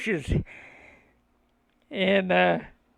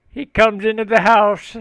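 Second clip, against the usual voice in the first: ordinary delivery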